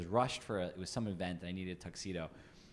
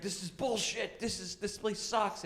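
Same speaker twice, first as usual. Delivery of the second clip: higher, slow mock complaint; retold rant; 13 semitones higher, slower than usual. [name] imitating his old self at the store, mock angry rant. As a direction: grumble at the store like it's their fault. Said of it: "I would yell like it's their fault".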